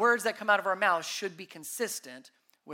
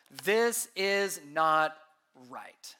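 slow and deliberate; 4 semitones lower, much slower than usual. Acted. Scripture read for emphasis.

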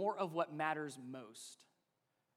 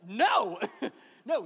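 louder, laughing through the no; 4 semitones higher, 10 dB louder than usual. Real, not acted. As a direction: laugh as you turn them down. Laughing while refusing kids.

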